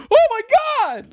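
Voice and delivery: very high, loud mock scream of amazement; hammed-up shrieked disbelief; cartoonish shriek. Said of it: "it was like a cartoon".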